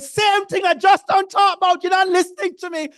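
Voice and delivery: mock-yell it like a fed-up friend, fast; vexed shout. Irritated Barbadian friend yelling at speaker, imitated angry shout. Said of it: "And he said".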